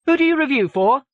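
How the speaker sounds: high, loud phone query; publicity assistant. A narrator's reading. Publicity staffer screening an unknown caller, high and loud. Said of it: "a voice said".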